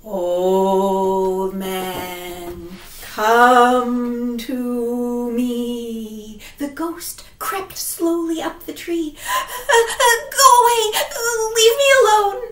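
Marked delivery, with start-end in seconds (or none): 0.0-6.4 slow, low haunting call; drawn-out ghostly summons; ghost woman. Acted. Ghost calls. 9.1-12.4 high, loud, near tears; high terrified pleading; panicked old man. Acted.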